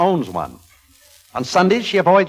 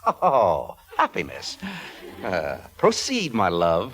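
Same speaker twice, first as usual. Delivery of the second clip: smug; 6 semitones lower, 4 dB quieter, slower than usual. Acted. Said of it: "in that smug way".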